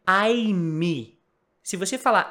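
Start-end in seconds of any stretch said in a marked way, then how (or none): 0.1-1.2 "I'm" with an extra "ee", as "I-mee"; wrong I'M. Shown as a demonstration. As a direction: say "I'm" as two beats, "ai-MI". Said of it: "não é". ("I'm" errado, com i no final)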